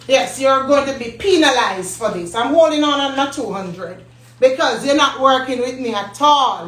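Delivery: ordinary talk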